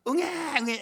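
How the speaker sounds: drawn-out mock newborn wail; imitated baby cry. Preacher imitating the baby heard under the bridge, mock wail. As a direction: mimic a baby crying. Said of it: "애기 소리가 들리더라는".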